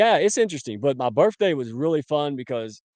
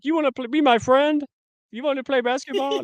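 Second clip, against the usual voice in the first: put-on much higher, childish little-kid voice; mock kindergartener; 14 semitones higher, 4 dB louder than usual. Host teasing his co-host's friend request, squeaky childish mock. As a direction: ask it like a small kid at recess. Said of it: "kindergarten skills".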